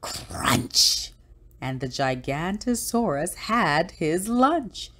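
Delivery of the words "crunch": drawn-out, crunchy sound-effect word; slow storybook crunch; crunching jaws. A narrator's reading. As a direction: chew through the word like giant jaws. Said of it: "The jaws went crunch".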